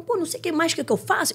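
high-pitched helpless complaint; imitating exasperated mother. Mother at her wits' end to the coach, mock exasperation. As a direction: plead in a high, fed-up voice.

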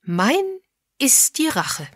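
tipsy, grand declaration; swooping, broken-up tipsy delivery. A narrator's reading. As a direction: proclaim it grandly with a few drinks in you. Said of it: "antwortete die Bibliothekarin beschwipst".

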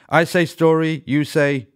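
high, loud call-out to start a chant; said loudly. A narrator's reading. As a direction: call it out loud like a teacher's cue. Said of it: "and said loudly".

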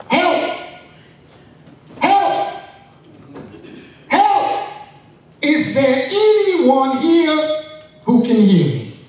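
high, desperate cries for help; yelling for help. Acted. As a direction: call out from the cliff, pleading. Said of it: "Jack continued to yell".

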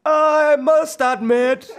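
very slow, high, loud drawl; Kat. Acted. Girlfriend makes an admission, slow high loud impersonation. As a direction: stretch each word out at full volume. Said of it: "Kat, Ben's girlfriend".

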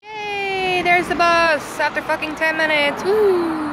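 high, drawn-out whooping celebration; excited cheer. Real, not acted. Mum spotting her bus after ten minutes, cheering.

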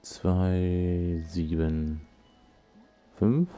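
slow reading out. Real, not acted. Reading code numbers slowly.

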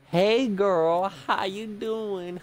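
high, drawn-out sing-song hello; playful flirty greeting. Pretend. Player greeting a game character, playfully flirty. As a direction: coo it, stretching the last words.

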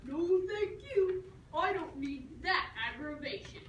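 dad declines to teach daughter, mildly voiced; dad's refusal. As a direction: turn it down like a weary dad. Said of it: "he was just like".

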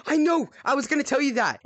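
high, loud, eager exclamation; excited interjection. Real, not acted. Player cutting in on friend's discovery, excited. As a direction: burst in, eager to have known first.

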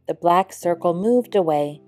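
ordinary delivery, nothing marked

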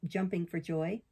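ordinary delivery, nothing marked

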